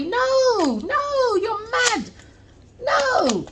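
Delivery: high, scornful no no; sing-song dismissive brush-off; mock squeal of refusal at the apology.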